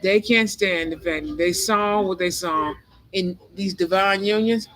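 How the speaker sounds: ordinary talk, nothing marked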